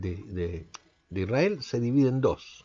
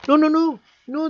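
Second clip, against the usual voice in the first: high, loud no no no; emphatic refusal; 13 semitones higher, 6 dB louder than usual. Acted. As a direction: protest it loudly as the crowd. Preacher voices people refusing.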